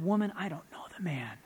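an unvoiced, fearful whisper; whispered denial. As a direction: deny him under your breath. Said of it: "Peter said".

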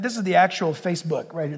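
ordinary talk, nothing marked

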